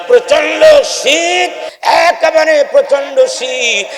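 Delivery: high-pitched sermon cry about the bitter cold; fervent preaching. Acted. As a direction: raise the voice to paint the freezing night.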